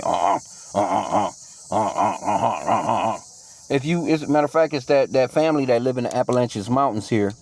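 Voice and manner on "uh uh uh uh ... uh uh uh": choppy, lower-pitched braying grunts in a put-on voice; mock donkey bray. Preacher mimicking how cave people sounded, grunting mock bray. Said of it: "This is how they were sounding".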